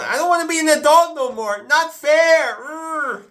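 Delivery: high, loud bratty whine; childish whine. Pretend. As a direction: whine like a sulking kid. Whining about adulthood.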